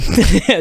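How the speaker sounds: high, short laugh. Real, not acted. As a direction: laugh through an apology. Laughing while apologising.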